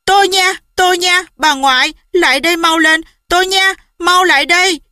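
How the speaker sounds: mother calling the family out in a panic; frantic calling. A narrator's reading. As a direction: call for help in a panic. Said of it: "chạy như cuồng trong sân rào kêu lên".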